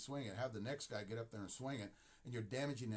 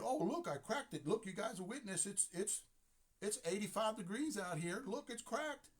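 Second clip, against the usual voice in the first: much higher, mocking whiny complaint; mock whiny player; 10 semitones higher than usual. Mocking a player who blames the hot weather, whiny and high. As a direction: imitate a player showing off his cracked bat.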